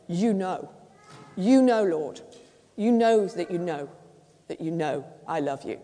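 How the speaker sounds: a heartfelt, pleading insistence; insistent plea. Acted. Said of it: "Only this time, he's saying".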